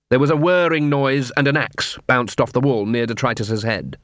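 ordinary delivery

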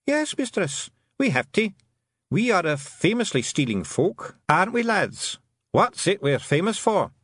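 high, rousing little Scottish clansman; Feegle leader. Acted. Feegle leader answers Tiffany.

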